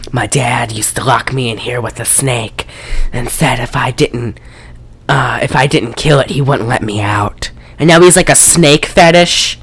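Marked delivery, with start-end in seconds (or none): 0.1-7.5 low, hushed retelling of the villain's confession, fading to a whisper; hushed creepy confession. Retold or imitated. Podcaster quoting the villain's snake story, hushed and whispered. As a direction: hush it like a creepy secret, trailing off breathless. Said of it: "and Himmel's like".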